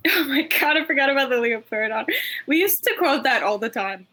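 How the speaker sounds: ordinary delivery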